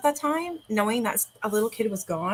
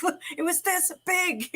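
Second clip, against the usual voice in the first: imitating son; 9 semitones higher than usual. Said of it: "And he's like".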